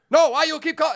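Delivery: put-on very high, loud Chinese-accented protest; hammed-up Chinese accent, quarrelling on the phone; irate mock Chinese accent. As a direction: act an exasperated restaurant worker with Chinese accent. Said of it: "make them keep calling each other".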